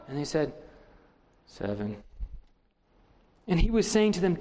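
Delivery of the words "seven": very soft, low sheepish murmur. A narrator's reading. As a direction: barely say it, ashamed. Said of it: "And sheepishly".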